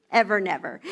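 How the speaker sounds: usual speaking voice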